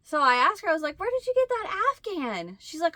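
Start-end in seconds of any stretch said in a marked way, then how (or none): 1.0-2.6 high curious question. Retold or imitated. Asking sister about blanket.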